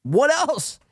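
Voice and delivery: high, teasing voice; high mocking voice. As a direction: pitch it way up to mock him. Mocking Chad's voice.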